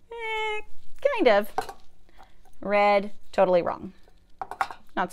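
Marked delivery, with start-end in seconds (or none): 0.1-1.5 a high, doubtful "eh"; a wavering "meh" of a so-so sound. Shown as a demonstration. Rating a so-so R.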